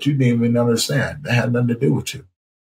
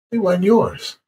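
raised, solemn voice of the Lord; voice of God; 7 semitones higher than usual. Retold or imitated. The voice answering his grief, solemn and raised. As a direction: answer as the higher voice, firm and final. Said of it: "And the voice said".

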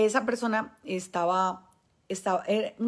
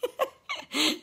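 a burst of real laughter; high laugh. Real, not acted. Preacher laughing after telling the story.

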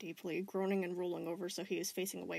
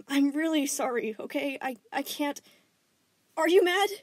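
higher, halting, rising to loud; anxious apology; 3 semitones higher, 3 dB louder than usual. Acted. Connie anxiously apologizes to Stephen, ending loud. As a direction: stumble then burst out the question.

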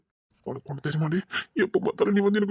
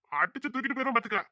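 ordinary talk, nothing marked

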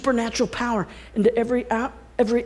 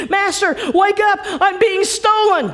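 high, loud cry of alarm; a stolen harp calling for help; 10 semitones higher, 5 dB louder than usual. Acted. Harp shouting to wake its sleeping master. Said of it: "the harp begins speaking".

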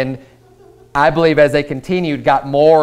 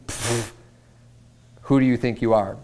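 scoffing; 3 semitones lower, 7 dB quieter than usual. Retold or imitated. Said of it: "with this attitude of like".

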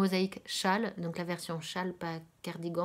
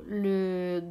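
ordinary delivery

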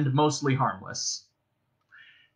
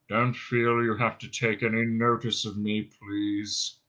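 depressed robot's dreary monotone; muffled drone; 4 semitones lower, slower than usual. Acted. Marvin from face down in the dust, muffled drone. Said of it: "came a muffled drone".

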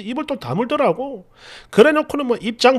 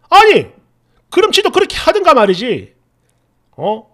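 exasperated complaint, pitched up; indignant outburst; 5 dB louder than usual. Acted. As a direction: burst out in indignation, then ease slightly. Narrator voices indignation at the wife.

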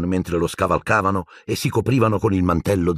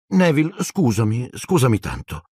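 apologetic; 6 semitones higher than usual. A narrator's reading. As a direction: apologise sorrowfully, starting pitched up. Hermione apologises before hexing Neville. (dispiaciuta, voce acuta che scende)

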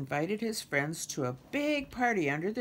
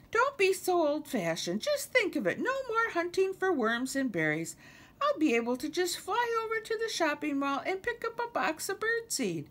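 very high, gushing bird voice; excited mockingbird; 4 dB louder than usual. Lulu sells Kermit on the mall, excited high bird. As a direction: gush in a high-pitched bird voice.

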